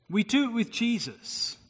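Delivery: ordinary delivery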